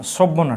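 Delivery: সভ্য hit firmly as the word to check; stress on সভ্য. Shown as a demonstration. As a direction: press on সভ্য then drop on না. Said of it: "দন্ত স না".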